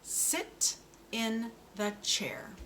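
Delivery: "Sit in the chair" said slowly and carefully; slow model sentence. Shown as a demonstration. Speech therapist models a sentence for a child, slow. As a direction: give each word its own time. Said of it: "this sentence will have".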